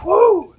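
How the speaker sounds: very high, loud whoop; pained whoop. Real, not acted. Vlogger at the peak of the burn, high loud whoop. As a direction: shout the heat out.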